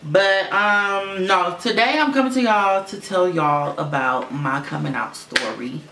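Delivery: ordinary talk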